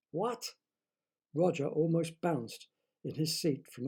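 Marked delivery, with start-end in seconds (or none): 0.1-0.5 astonished what. Acted. Roger astonished.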